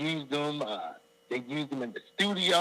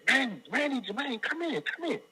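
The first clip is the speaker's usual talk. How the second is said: high-pitched urgent beckoning; eager, high retold call; excited summons; 11 semitones higher than usual. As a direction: call your bandmates into the room. Calling bandmates in from hall.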